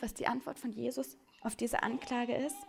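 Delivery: ordinary talk, nothing marked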